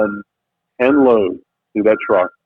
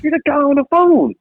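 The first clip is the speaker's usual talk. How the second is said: excited stranger. Retold or imitated. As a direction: mimic a fan who suddenly recognizes him. Stranger recognizing him, excited.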